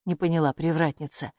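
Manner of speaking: plain reading voice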